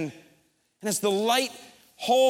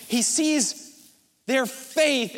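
ordinary talk, nothing marked

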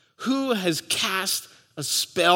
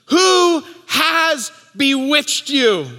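mock yell; 6 semitones higher, 3 dB louder, slower than usual. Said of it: "He's yelling".

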